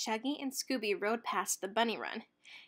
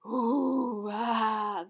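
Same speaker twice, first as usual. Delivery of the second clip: monster moan; 5 dB louder than usual. A narrator's reading. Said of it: "the monsters moaned".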